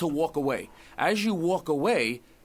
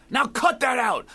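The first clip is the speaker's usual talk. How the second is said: loud, higher mock reprimand; mock priest's scolding; 7 semitones higher, 6 dB louder, slower than usual. Priest to penitent in confession, mock scolding. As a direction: act out a priest's sudden telling-off.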